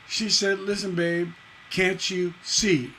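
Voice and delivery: slow "can't you see", ending on a stressed "see"; stress on final SEE. Shown as a demonstration. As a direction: land hard on "see" at the end. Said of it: "Again, stress on the last line".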